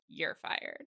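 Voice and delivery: softly mouthed mock dismissal; mock silent firing. Co-host voices colleague's look, hushed mock firing. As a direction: barely voice it, deadpan. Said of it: "I'm watching Christina's face".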